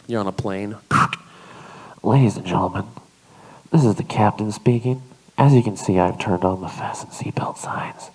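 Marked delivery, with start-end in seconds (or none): 2.0-8.1 pilot intercom. Pretend. Pilot announcement, whispered bullshit.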